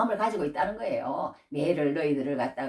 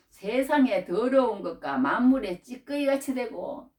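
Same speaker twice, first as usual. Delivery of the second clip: solemn quote; 6 semitones higher, 4 dB louder, slower than usual. Acted. Scripture quoted in preaching.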